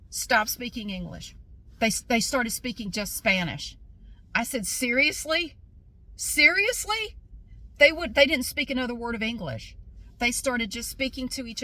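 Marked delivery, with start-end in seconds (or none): 4.7-5.6 raised, disbelieving question; incredulous. Retold or imitated. Customer to staff suddenly speaking Spanish, incredulous. 6.2-7.2 very high, drawn-out disbelieving question; utterly incredulous. Retold or imitated. Customer stunned that staff dropped English, utterly incredulous.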